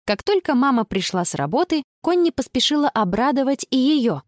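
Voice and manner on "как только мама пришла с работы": ordinary delivery, nothing marked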